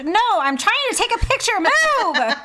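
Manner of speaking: loud, high mock shout; mock exasperated yell. Imitating an annoyed photographer shouting at a tourist.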